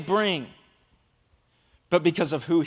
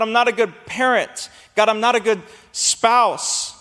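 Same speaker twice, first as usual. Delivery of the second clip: raised, full-force sermon delivery; preaching; 4 semitones higher than usual. Acted. Pastor preaching to church, full force. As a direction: preach it out to the congregation.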